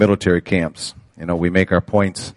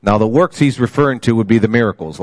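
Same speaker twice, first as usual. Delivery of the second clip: ordinary talk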